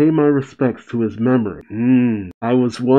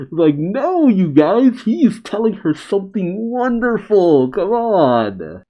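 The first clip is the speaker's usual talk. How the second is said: exasperated; 7 semitones higher than usual. Real, not acted. Frustrated at Straw Hats misunderstanding.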